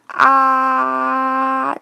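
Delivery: long held "啊", open ah; drawn-out open first vowel "啊"; vowel demo. Shown as a demonstration. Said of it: "是你在发出来啊的".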